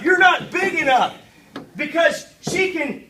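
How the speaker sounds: normal talking voice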